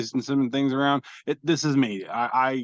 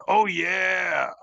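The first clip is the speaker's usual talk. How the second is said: Kool-Aid man; 5 semitones higher than usual. Acted. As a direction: burst out with a booming mascot cheer. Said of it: "animated Kool-Aid man going".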